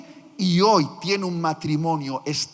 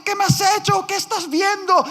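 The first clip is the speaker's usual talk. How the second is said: mock outraged wife; 10 semitones higher, 4 dB louder than usual. Esposa furiosa, retomada por el pastor.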